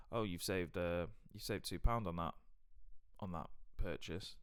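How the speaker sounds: low-key, matter-of-fact mumble; imitating an understated British shop worker; unimpressed UK cashier. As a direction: mention it as no big deal. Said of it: "in a counter bar in the U K".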